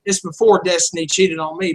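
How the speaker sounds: ordinary delivery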